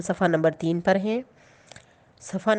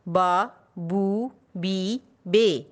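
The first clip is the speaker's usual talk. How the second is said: each syllable drawn out, one by one, slowly; slow clear Urdu syllables for children; slow syllable drill; much slower than usual. Shown as a demonstration. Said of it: "مصوت الف کے ساتھ ملائیں گے".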